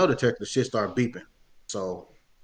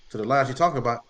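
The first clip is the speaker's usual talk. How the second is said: usual speaking voice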